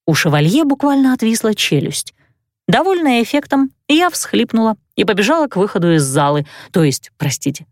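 plain reading voice

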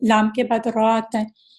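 ordinary talk, nothing marked

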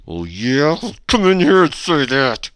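loud, high, drawn-out bully challenge; mock tough guy. Host answering a bullying charge, mock threatening. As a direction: dare them loudly in a put-on bully voice.

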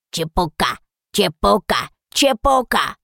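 croaky parrot-dragon repeat; croaking. A narrator's reading. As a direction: croak it, rising each time. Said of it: "проквакал Драго".

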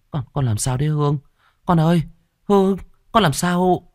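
high, anxious calling; a mother frightened for the girl; worried mother. A narrator's reading. (người mẹ lo lắng gọi con)

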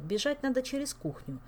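ordinary delivery, nothing marked

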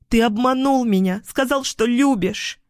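high, hurt and angry; hysterical accusation. A narrator's reading. Hysterical woman accuses him. (обиженно-истерично, высоким голосом)

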